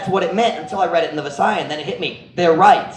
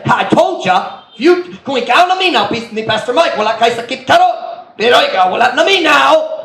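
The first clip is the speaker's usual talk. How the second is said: preachy I-told-you-so lecture, pitched higher and louder; mock lecturing pastor; 6 semitones higher, 4 dB louder than usual. Preacher mimics a pastor lecturing an injured man, preachy and scolding. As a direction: scold him like a know-it-all pastor at his bedside.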